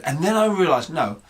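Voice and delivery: normal talking voice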